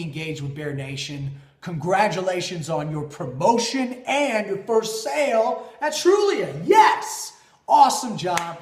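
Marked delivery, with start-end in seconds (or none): 1.6-8.6 big rising cheer ending in a shouted yes; excited shout-out. Real, not acted. Host cheering on a viewer's first sale, excited. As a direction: celebrate her win loudly and high.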